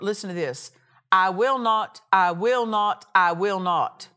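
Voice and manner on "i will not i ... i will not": each I will not driven home louder; emphatic repetition. Acted. Preacher to congregation, louder emphatic I will not. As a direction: pound out every I will not. Preacher hammering God's promise.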